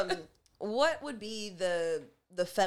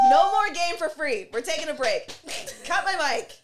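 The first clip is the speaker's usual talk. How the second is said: loud; 8 semitones higher, 8 dB louder than usual. Real, not acted. Calling out end.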